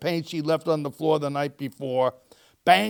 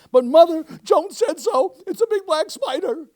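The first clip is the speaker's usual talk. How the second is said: put-on very high, loud childish squeal; 14 semitones higher, 8 dB louder than usual. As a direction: protest in a panicked little-girl squeal.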